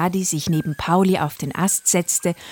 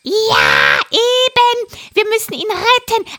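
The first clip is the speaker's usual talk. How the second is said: high, loud parrot voice; a frantic parrot urging; excited parrot; 7 dB louder than usual. Acted. Parrot voice held. (hohe, aufgeregte Papageienstimme)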